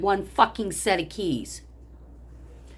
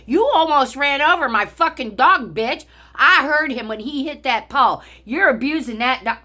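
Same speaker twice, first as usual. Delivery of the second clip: loud, higher angry yell at the driver; retold angry yelling; 7 semitones higher, 6 dB louder than usual. Yelling at the woman in the SUV, angry and loud. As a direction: snap back at her furiously. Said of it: "I said".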